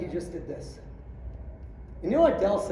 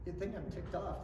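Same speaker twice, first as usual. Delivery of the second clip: ordinary delivery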